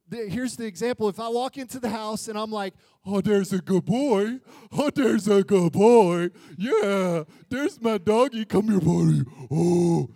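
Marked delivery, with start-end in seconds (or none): 3.0-10.1 put-on loud, silly doggy baby talk; hammed-up praise for a pet; gushing dog talk. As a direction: fuss over the dog like a doting owner. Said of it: "I'm acting a fool for my dog".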